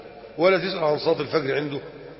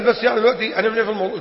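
rushed question; 5 semitones higher, much faster than usual. Retold or imitated. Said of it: "قال".

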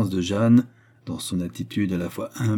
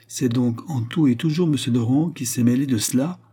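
raised, louder outburst; exclaiming; 5 semitones higher, 5 dB louder than usual. A narrator's reading. Queen hears Rohan named once more, exclaiming. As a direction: burst out with the question. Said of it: "s'écria la reine".

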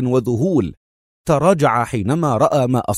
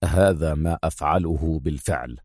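low, quietly firm reply; 6 semitones lower, 4 dB quieter than usual. A narrator's reading. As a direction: state it resolutely, pitched down. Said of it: "وهو يقول في حزم".

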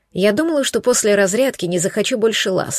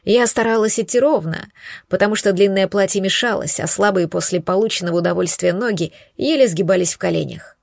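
usual speaking voice

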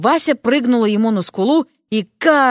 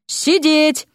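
high-pitched order to a beast; acted authoritative order; sharp command; 4 semitones higher than usual. A narrator's reading. Dean commanding demon.

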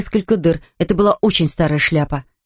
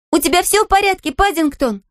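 high anxious call through door; 12 semitones higher than usual. Acted. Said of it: "кричала она".

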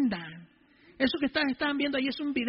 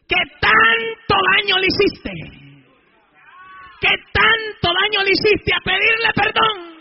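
full-force preaching; 9 semitones higher, 11 dB louder than usual. Real, not acted. God sending him to ask forgiveness, retold in shouted preaching. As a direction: hammer out each phrase loudly.

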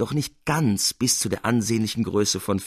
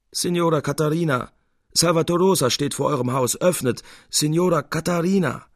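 raised voice calling up to a window; higher calling voice outside a house; 7 semitones higher than usual. Acted. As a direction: call up to the landlady at night. Salvator calling at the door.

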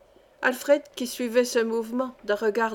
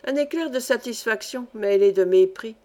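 ordinary delivery, nothing marked

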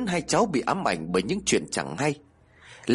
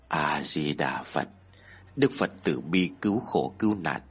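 solemn Buddhist monk; 6 semitones lower than usual. A narrator's reading. Monk praying for the widow after her story, solemn. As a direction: intone a blessing low and calmly.

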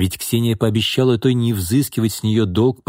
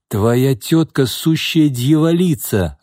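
higher, slow, stressed-out complaint; irritated; 5 semitones higher, 3 dB louder, slower than usual. A narrator's reading. Pyotr complains to Alexei about his aunt, irritated. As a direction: vent it with drawn-out emphasis. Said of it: "раздражённо сказал Пётр".

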